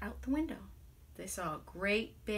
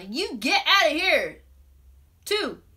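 loud, higher, rising fed-up yell; angry townsfolk; 6 semitones higher, 6 dB louder than usual. Retold or imitated. Townspeople banishing the magician, angry yell. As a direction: drive them out of town. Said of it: "People said to the father".

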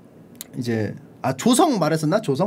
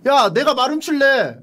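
whiny plea; 7 semitones higher, 4 dB louder than usual. Retold or imitated. Song Xian pleading again.

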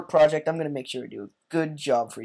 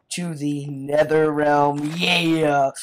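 slow, loud battle cry rising to a yell; rallying cheer; 6 dB louder, much slower than usual. Pretend. Player setting off for the nether realm, rallying cheer. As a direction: announce the charge, stretching it out.

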